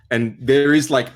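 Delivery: ordinary delivery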